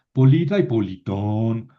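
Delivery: "Polita y politón", stress moving from LI to a final TÓN; shifting accent. Shown as a demonstration. Teacher contrasts the plural cases, moving the stress to the end in "politón". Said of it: "el acento no se mueve más que en genitivo plural".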